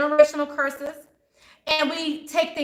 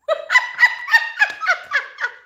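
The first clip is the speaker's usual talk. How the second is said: very high, loud peal of laughter; 5 dB louder than usual. Real, not acted. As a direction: crack up at yourself. Laughing at how she looked.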